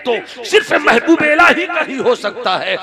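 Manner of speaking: high, loud, aching cry of recognition; crying out with longing. Acted. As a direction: voice rising in yearning wonder. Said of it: "तड़प कर कहती है". (तड़प कर पुकारती हुई)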